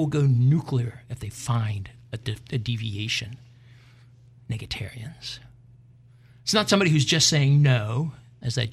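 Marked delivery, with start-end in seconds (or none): 1.1-5.4 soft whispered aside. Real, not acted. Host hushes into a whisper about negatarians. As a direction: drop to a whisper.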